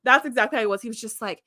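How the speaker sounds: ordinary delivery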